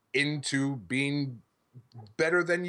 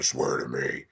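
deep, gravelly-free low growl of a movie Batman; 14 semitones lower than usual. Retold or imitated. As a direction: drop way lower into a grim Batman rasp.

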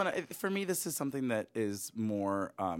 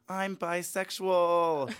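retold scream; 6 semitones higher, 4 dB louder, much slower than usual. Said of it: "she screams".

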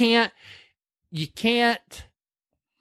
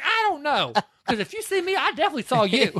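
laughing through a high squeaky line; high laughing reply; 12 semitones higher than usual. Retold or imitated. Father answering daughter, high and laughing. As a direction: giggle it out in a high voice. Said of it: "He's like".

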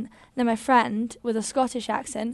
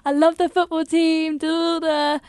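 a touch of put-on Scouse, pitched up; fake Liverpudlian; 5 semitones higher than usual. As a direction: lightly imitate an eager Liverpool fan, higher. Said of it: "like a fake Liverpudlian".

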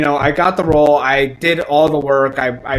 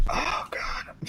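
breathy whispered sigh of frustration; under the breath; 15 dB quieter, slower than usual. Real, not acted. Guest straining to recall a show's name, whispered. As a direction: whisper it to yourself while trying to remember.

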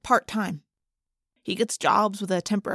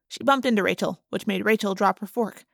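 usual speaking voice

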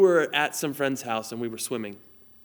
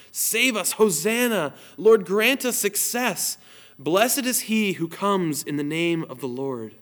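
higher, louder, slow pleading read; fervent scripture plea; 4 semitones higher, 4 dB louder, slower than usual. Acted. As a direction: cry out the verse to God. Reads psalm aloud.

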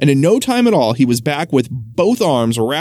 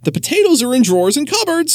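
high, eager slip; banker's wife blurting; 13 semitones higher than usual. Acted. Banker's wife blurting where the potatoes are, high.

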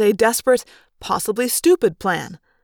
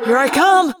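higher, louder battle call; excited yell; 5 semitones higher, 5 dB louder than usual. A narrator's reading. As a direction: yell it out as you dive in. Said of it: "Tobias yelled".

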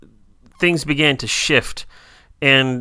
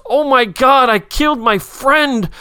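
high, lightly voiced despair; anguished inner voice; 11 semitones higher than usual. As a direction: voice a guilt-stricken inner cry. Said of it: "what you hear is".

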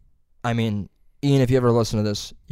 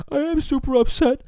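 much higher, louder, tearful wail; crying man's reply; 5 dB louder than usual. Retold or imitated. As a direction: whine it like a big guy bawling. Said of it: "He's like".

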